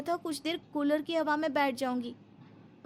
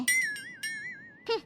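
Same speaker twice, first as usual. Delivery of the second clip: sulky hum; 7 dB quieter, much slower than usual. Acted. Sulky reply to mother-in-law.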